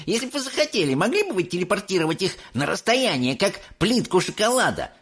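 put-on high, fast pestering kid; excited boy. A narrator's reading. Mike pestering Wonka with questions, excited. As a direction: rattle off the question eagerly. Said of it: "не отставал Майк Телек".

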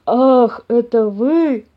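loud, hearty bear greeting; delighted bear. A narrator's reading. Papa bear recognising his visitors, delighted. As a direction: sound pleased in a big bear's voice. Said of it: "обрадовался папаша Бамсе".

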